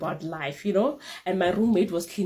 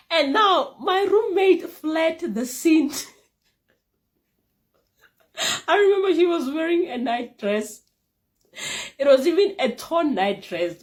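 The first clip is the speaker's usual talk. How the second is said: hyped punchline; 11 semitones higher, 5 dB louder than usual. Real, not acted. Dramatic comic punchline.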